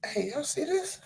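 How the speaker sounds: high, hushed, fast disbelief; stunned aside to friends; astonished whisper-aside. Retold or imitated. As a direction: nudge friends in shock. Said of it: "I'm sitting there like".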